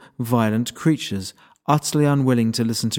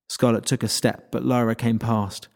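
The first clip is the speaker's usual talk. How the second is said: ordinary delivery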